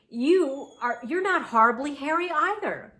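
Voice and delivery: high, loud rabbit disbelief; incredulous. A narrator's reading. Rabbit challenging the monster.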